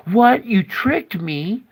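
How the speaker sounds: high, loud startled what; Satan stunned at God's trick; shocked disbelief. Retold or imitated. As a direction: yelp as the trap closes. Satan caught off guard.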